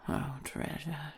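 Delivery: usual speaking voice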